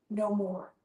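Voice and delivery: normal talking voice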